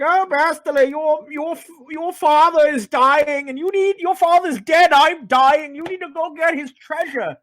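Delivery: high, urgent mock plea from a dying mother; mimicking Bastila's mother. Mother urging Bastila to fetch her father's treasure, high mock pleading. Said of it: "and she's like".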